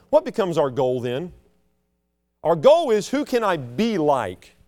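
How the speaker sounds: normal talking voice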